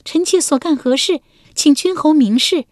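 a touch of forced defiance, pitched high; lightly voiced wife steeling herself; bracing herself. A narrator's reading. Wife challenging her husband with mild forced defiance. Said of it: "文姜硬着头皮道".

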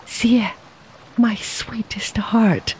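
bittersweet, breathing it out; whispered joy touched with sorrow; glad and sad. A narrator's reading. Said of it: "his voice both glad and sad".

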